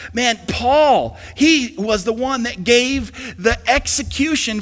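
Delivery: loud, high-pitched preacher's cry; full-force preaching. Real, not acted. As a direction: preach it with mounting fervor, punching the key words.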